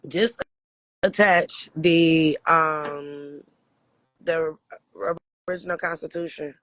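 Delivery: normal talking voice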